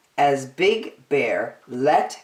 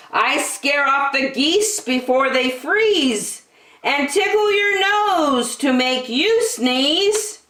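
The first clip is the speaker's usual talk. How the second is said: higher, proud wind boasting; mighty shout; 8 semitones higher than usual. Acted. The wind boasting to Big Bear, higher and proud. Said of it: "mighty shout".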